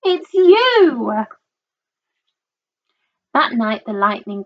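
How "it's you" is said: high, swooping, drawn-out exclamation; amused exclamation. A narrator's reading. Duckbills laughingly tell Drip it's his reflection, amused exclamation. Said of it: "And they laughed and said".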